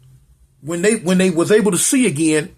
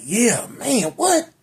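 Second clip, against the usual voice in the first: surprised what; 4 semitones higher, slower than usual. Retold or imitated. Group reacts to magic.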